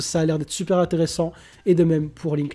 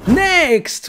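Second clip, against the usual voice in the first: high, punchy shout; excited high call; shouted announcement; 7 semitones higher, 4 dB louder than usual. Real, not acted. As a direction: call it out loud to move things on. Shouts to launch next duel.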